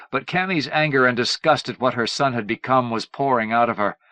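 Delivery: usual speaking voice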